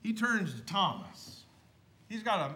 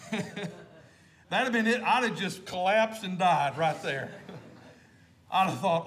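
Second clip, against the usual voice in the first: usual speaking voice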